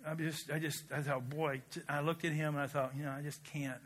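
low, soft, halting reflection; real, subdued grief. Real, not acted. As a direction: speak quietly, weighed down by a friend's loss. Recalls friend's son being killed.

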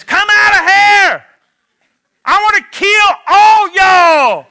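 angry big brother calling the bullies out; furious shout. Retold or imitated. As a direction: bellow it up the driveway at the boys.

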